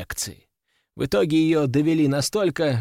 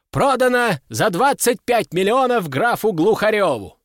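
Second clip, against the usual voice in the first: raised, ringing sale call; auctioneer's announcement; 6 semitones higher, 3 dB louder than usual. A narrator's reading. Auctioneer declares the crown sold to the count, high announcement.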